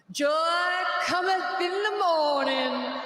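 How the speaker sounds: slow, sing-song mock preacher voice. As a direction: ham up a revival preacher's promise. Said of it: "my favorite was the preacher accent".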